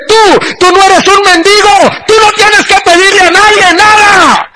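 voz muy aguda y quebrada por el llanto; llorando. Real, not acted. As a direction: repite la palabra del Señor con lágrimas reales. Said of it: "empecé a hablar en otras lenguas y a llorar". (voz rota por el llanto, mucho más aguda)

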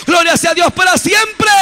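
loud, high praise shout to the congregation; preaching at full force. Acted. Preacher shouting praise to his congregation, fervent. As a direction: lift it up, proclaim God's glory.

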